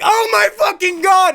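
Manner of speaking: high, loud, stretched-out outburst; exasperated yell. Retold or imitated. Lost man reacts to Schindler's useless directions, exasperated yell. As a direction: explode at a useless answer.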